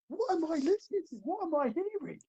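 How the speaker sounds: high-pitched, incredulous outcry; mock shock. Acting out his reaction while watching the video, mock shock. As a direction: squeal it in disbelief, hammed up. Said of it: "I'm just in shock".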